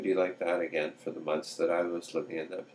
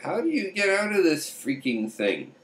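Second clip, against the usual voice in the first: exasperated husband; 11 semitones higher than usual. Retold or imitated. Husband stuck in corset, background.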